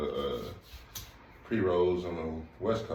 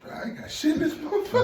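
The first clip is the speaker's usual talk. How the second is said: high-pitched laughing outcry; loud, squeaky mock despair; mock dismay; 5 dB louder than usual. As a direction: act out comic dismay, cracking up. Acts out high person finding no food.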